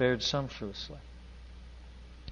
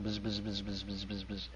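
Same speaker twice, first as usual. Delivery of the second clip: mumbled prayer buzz; 5 semitones lower, 11 dB quieter than usual. Retold or imitated. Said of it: "and she's praying".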